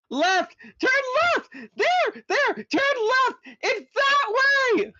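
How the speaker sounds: shrill, very high, loud screamed directions; screaming. A narrator's reading. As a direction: scream directions in pure panic. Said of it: "taking time out from screaming".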